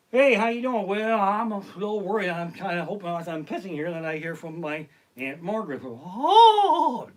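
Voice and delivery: loud, high chatty voices; chatty guys at the urinal. Retold or imitated. Chatty guys in men's room.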